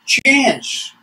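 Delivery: high, loud one-word greeting; hammed-up chief hailing a woman; chief's greeting. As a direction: call it out high, falling away. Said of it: "He'd say".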